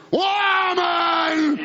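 "Woman!" shouted out with force; shouted call. Shown as a demonstration.

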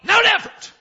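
high, very loud emphatic repeat; shouted punchline. Acted. Preacher reporting vitamins did nothing, shouting. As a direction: belt it out to drive the joke home.

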